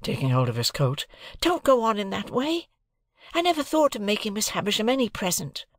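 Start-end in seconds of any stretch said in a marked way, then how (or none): 1.4-5.6 a lightly voiced boy pleading, higher; desperate plea. A narrator's reading. Pip holding Joe's coat, pleading in mild desperation. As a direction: beg him to stop, half in despair. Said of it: "I cried in desperation".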